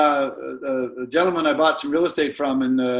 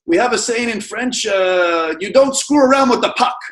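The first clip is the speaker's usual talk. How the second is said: higher, louder mock French Canadian accent; 6 semitones higher, 3 dB louder than usual. Quebec seller praising Doctor Tom in a light French Canadian accent. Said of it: "in his good French Canadian accent".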